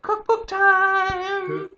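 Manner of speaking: high, slow, stretched cheer; drawn-out excited shout. Real, not acted. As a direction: sing out the goal like a victory call. Cheering the cookbook goal.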